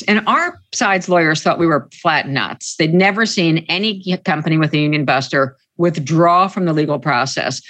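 usual speaking voice

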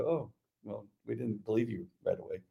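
mock sheepish admission. Colleagues admitting they didn't believe officer, sheepish. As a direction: imitate colleagues quietly owning up.

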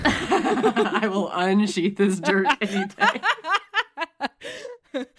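slow words broken up by laughter; laughing hard. Real, not acted. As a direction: get it out while cracking up. Cracking up at innuendo.

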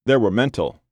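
ordinary delivery

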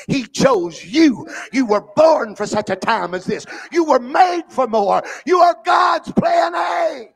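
high, loud, slow shouted climax; preaching shout. Real, not acted. Preacher to congregation, shouting that God chose them. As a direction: shout it out to the whole church, drawing each word out.

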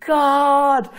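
drawn-out high cry, held on one note; shouting. Retold or imitated. Man calling to God inside a noisy plant's tank, shouting. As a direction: send the word echoing up, sustained. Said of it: "as I'm shouting".

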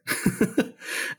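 soft, breath-only chuckle; embarrassed little chuckle; short laugh. Real, not acted. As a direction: laugh it off after a slip. Laughs at losing his place.

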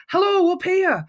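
high, loud call upward; retelling a shout from a stool. Retold or imitated. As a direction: yell to catch his eye from above. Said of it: "I just shouted".

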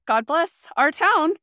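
exasperated sarcasm about the hometown; sarcastic blessing. Retold or imitated. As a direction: drag out town with exasperated sarcasm. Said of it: "And I was like".

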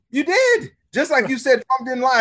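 high, incredulous insistence; retold insistent retort. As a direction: pitch jumps high on the comeback. Retold argument, insisting on contradiction.

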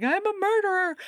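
high-pitched wail of mock panic; mock freak-out. Woman who just shot a man, mock freaking out. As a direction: wail it high, hamming up the distress. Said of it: "she starts freaking out about that".

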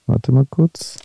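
ganz leise, tonlos vor sich hin; geflüstert. Real, not acted. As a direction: murmelt es für sich beim Suchen. Sucht nebenbei die Sprachnachricht. (flüstert beim Suchen der Sprachnachricht vor sich hin)

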